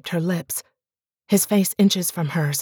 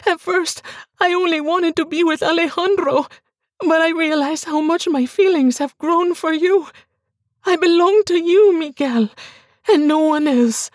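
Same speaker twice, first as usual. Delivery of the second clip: much higher and louder, begging; desperate pleading wife; 11 semitones higher, 4 dB louder than usual. Acted. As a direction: beg him for belief. Bianca confesses love.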